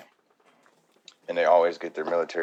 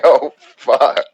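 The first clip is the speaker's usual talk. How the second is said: much louder, higher cry; mock panicked victim; 8 semitones higher, 16 dB louder than usual. Fan voicing the doomed victim, much louder and higher. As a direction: yelp it as the victim. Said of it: "It's like".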